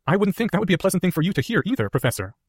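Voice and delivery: put-on a clever boy weighing his words; earnest schoolboy reasoning; young Harry voice.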